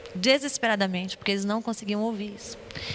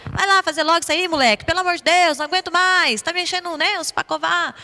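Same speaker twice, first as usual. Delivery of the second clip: exasperated parent yell; 7 semitones higher, 7 dB louder than usual. Retold or imitated. Fed-up parent yelling at child.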